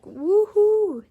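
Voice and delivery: loud high woohoo, drawn out; excited whoop. Real, not acted. Reader whooping at the card, excited. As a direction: cheer it out, delighted.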